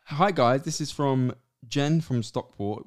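email read-out. Real, not acted. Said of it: "reading listener email aloud".

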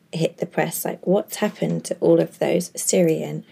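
ordinary delivery

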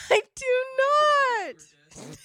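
high-pitched protest through laughter; laughing protest. Real, not acted. Teased about snoring by a parent, laughing protest. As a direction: deny it while cracking up.

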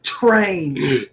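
Train with an exaggerated opening T; over-enunciated T in Train; hard T. Shown as a demonstration. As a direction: lean on the T that starts Train. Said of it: "Saying your T's a little harder".

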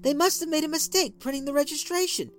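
wife anxiously explaining to angry husband; nervous explanation. A narrator's reading. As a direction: rush to explain, scared. Wife pleading explanation, high.

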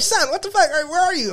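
very high alarmed mom voice; worried mom. Retold or imitated. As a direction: imitate a panicked mother. Mom's alarmed reply, imitated.

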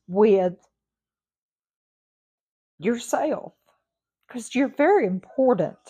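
pushing through rising emotion as she urges her listeners; getting emotional. Real, not acted. Podcast host urging listeners to value themselves, choked up and emotional. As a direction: voice rising high and tight with emotion, near tears. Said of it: "I'm sorry that I got a little bit emotional".

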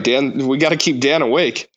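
normal talking voice

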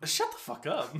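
mock outburst breaking into laughter; laughing outburst. Fed up with kids saying bruv, laughing outburst. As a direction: crack up while saying it. Reacting to kids' slang, cracking up.

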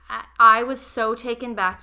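normal talking voice

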